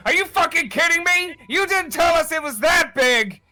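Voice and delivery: high, loud panicked yell; panicked elf. Acted. Elf yelling at the party mid-chase, panicked shout. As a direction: scream it as a fleeing elf. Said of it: "this elven figure just go".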